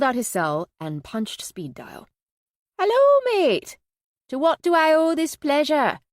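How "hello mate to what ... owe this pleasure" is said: put-on Yorkshire accent. A narrator's reading. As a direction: sing out a cheery hello in a Yorkshire accent. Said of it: "Minnie's Yorkshire accent always made Gaines smile".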